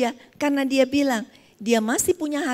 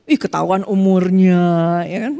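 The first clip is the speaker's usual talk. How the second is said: mock teasing; 4 semitones lower, 11 dB louder, slower than usual. Real, not acted. Teasing the audience about age.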